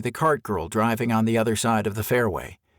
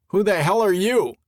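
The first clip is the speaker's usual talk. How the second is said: higher, louder wary call; calling back; 8 semitones higher, 4 dB louder than usual. A narrator's reading. Greg calling back to the hidden camper, wary shout. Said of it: "he called back".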